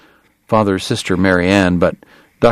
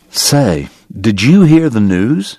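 newsy doctor; 4 dB louder, slower than usual. A narrator's reading. Doctor springs news on the guest.

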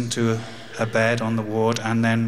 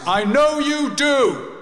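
loud, much higher and slow call-out; 4 dB louder, much slower than usual. Retold or imitated. Father calling after his leaving son, loud and slow. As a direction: call after him across the ward. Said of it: "in a loud voice".

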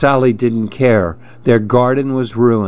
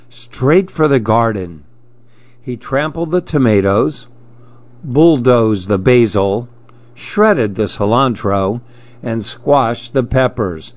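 ordinary delivery, nothing marked

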